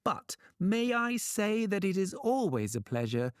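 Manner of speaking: high, oily courtly flattery; butter them up in a high voice; flattering trickster. A narrator's reading. Trickster bows to king and thunder god, flattering. Loki flatters Odin and Thor.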